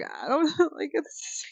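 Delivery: high, broken-up imitated crying; mock crying. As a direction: act out tearfully not knowing. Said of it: "like, I'm just crying".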